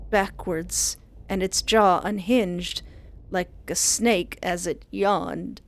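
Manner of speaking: ordinary delivery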